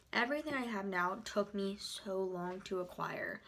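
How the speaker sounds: usual speaking voice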